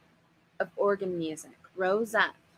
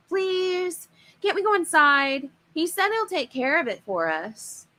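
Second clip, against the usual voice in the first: put-on much higher begging child's voice; pleading kid brother; 8 semitones higher, 4 dB louder than usual. Younger brother begging Dad to go into the park, high pleading whine.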